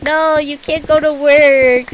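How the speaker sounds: whiny plea. Retold or imitated. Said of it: "I'm like".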